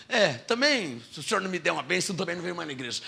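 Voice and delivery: soft, rushed sulky bargaining; mock petulant threat. Believer threatening God to stop coming to church, sulky. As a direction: mutter the threat like a spoiled child.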